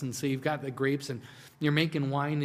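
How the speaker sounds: ordinary talk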